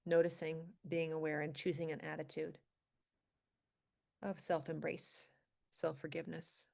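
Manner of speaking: calm meditation guide with long pauses; guided meditation. Acted. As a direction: ease them gently into stillness, leaving space between phrases. Leads a centering meditation.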